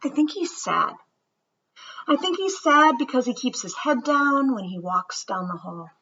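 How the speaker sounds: put-on gentle read-aloud storytelling for children; story reading. Teacher reading a story to her students, storybook voice. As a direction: read it to the class like a picture book.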